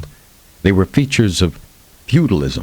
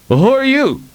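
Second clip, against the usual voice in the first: suspicious sentry shouting; guard calling down. Acted. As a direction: call down at a stranger from the gate. Guard calls down from the gate.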